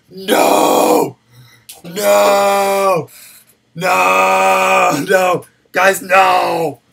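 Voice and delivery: loud, drawn-out wail of protest; mock-anguished shout. Streamer told he is already like McAfee, mock-horrified shouting. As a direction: cry out as if doomed.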